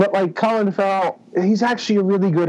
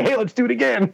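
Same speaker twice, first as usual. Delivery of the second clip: mock studio exec; 7 semitones higher than usual. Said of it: "before they say".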